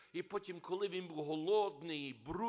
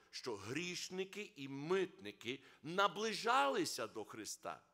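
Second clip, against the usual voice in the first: ordinary talk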